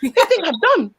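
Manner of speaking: lightly voiced resentful inner voice; mock resentful mom's inner voice; mock indignant inner voice. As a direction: grumble it as the offended inner voice. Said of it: "you in your voice be like".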